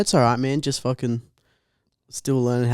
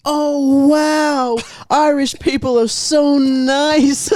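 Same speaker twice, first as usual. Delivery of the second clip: high, slow, breathless gushing; ditzy girl voice; 13 semitones higher, 3 dB louder, much slower than usual. Retold or imitated. Mimicking the girl who baits the scammer, high and slow. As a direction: play the gullible girl, wide-eyed. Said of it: "the girl's just like".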